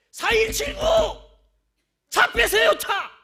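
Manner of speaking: very loud, high shout; shouted announcement. Retold or imitated. Parking attendant yelling a car number during worship, very loud. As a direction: bellow the plate number across the room.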